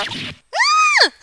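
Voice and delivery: sudden high scream of fright; a short, sharp frightened scream; startled scream. Acted. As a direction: shriek in shock.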